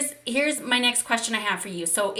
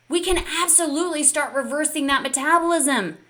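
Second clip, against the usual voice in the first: higher and louder, rallying the crowd; pumped-up hype; 4 semitones higher, 5 dB louder than usual. Real, not acted. Coach tells viewers they can reverse metabolism, rousing loud hype. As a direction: fire them up like a coach. Hyping answer to big question.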